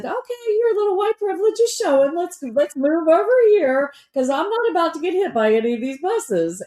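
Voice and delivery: very high, playful scolding voice; teasing warning. Retold or imitated. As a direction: tease her while steering her away. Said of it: "you said".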